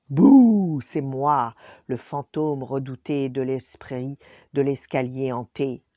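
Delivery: bouh et annonce fortes et menaçantes; fantôme menaçant. Acted. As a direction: se présente pour faire peur. Le fantôme menace le professeur. (Gaspard effraie le professeur, voix forte menaçante)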